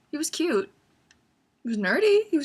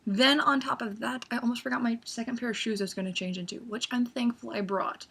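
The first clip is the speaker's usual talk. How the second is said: normal talking voice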